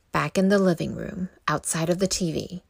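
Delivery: ordinary delivery, nothing marked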